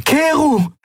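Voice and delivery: very high, desperate cry; shouting his name. A narrator's reading. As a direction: scream the name across the line. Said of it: "rief der Kapitän".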